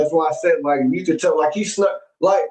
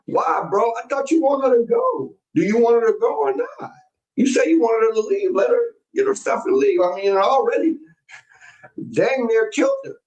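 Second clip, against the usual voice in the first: incredulous scolding; 8 semitones higher than usual. Real, not acted. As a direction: pitch rising, exasperated. Streamer scolding man in clip.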